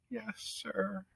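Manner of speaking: put-on young mouse meekly answering the judge; small Despero, meek.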